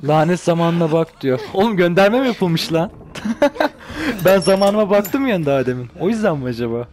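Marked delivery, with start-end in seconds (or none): 3.0-4.2 a high burst of laughter; amused laugh. Real, not acted. Gamer laughing at a sneaky reference in the game, bursting laugh. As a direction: crack up at the joke.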